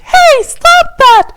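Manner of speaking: very high, loud, slow squeaked shout; squeaky shout. Acted. Tinkerbell yells after the diving Tavros in a squeaky high shout.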